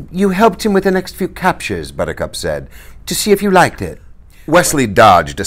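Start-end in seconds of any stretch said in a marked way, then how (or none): 0.1-1.9 put-on high, light young woman's voice; young woman voiced high and eager; Buttercup's voice. 3.1-4.0 put-on high, light young woman's voice; young woman voiced high and eager; Buttercup's voice.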